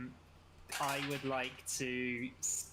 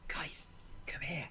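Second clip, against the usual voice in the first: hushed conspiratorial stage whisper; 4 dB quieter than usual. Acted. Player whispers to the others to take one out, whispering. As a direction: whisper a plan to your party. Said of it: "I whisper to the others".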